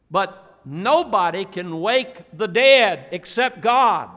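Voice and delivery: preaching climax. Acted. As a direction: build and hit God hard.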